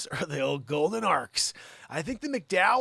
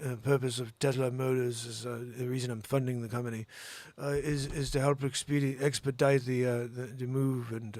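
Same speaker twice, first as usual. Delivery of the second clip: lightly voiced halting Elon Musk, lower and hesitant; Elon Musk impression; 6 semitones lower, 4 dB quieter than usual. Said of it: "do an Elon Musk impression for you".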